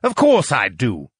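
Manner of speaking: haughty, mocking character voice. A narrator's reading. Lucian to the rival he knows well, mocking. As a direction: sneer it in a put-on voice. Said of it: "Lucian mocked".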